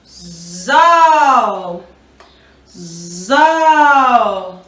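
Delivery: ZÓ drawn out with an open O; teacher sounding out a long, loud-voiced "Zó"; syllable sound demo. Shown as a demonstration. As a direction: buzz into "Zó" and hold the vowel. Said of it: "do Z com o O".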